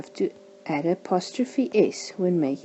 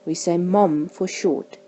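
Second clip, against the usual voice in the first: ordinary delivery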